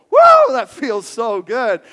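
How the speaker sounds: loud, slow, blissful sigh of delight; blissful hug. Retold or imitated. Pastor acting out a bear hug with Nick, loud and delighted. Bear hugging a fellow hugger.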